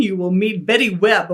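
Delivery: ordinary talk, nothing marked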